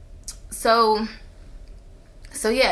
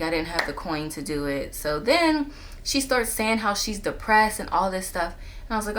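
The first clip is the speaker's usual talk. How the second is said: normal talking voice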